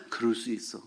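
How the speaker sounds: quiet, reassuring acceptance; calm understanding. Retold or imitated. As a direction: settle it down kindly. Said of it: "이렇게 가, 말이".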